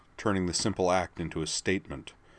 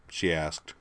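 ordinary delivery

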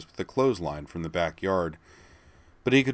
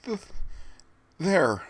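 frightened stammer; 11 semitones higher than usual. A narrator's reading. Terrified stammer.

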